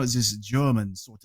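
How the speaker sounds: badly faked German accent; attempted German accent. Shown as a demonstration. Host demonstrating a comedian's voice, a hint of German accent. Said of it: "I can't do a German accent".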